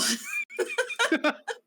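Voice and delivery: big burst of laughter; loud high laugh. Real, not acted. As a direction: crack up loudly. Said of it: "Oh, no".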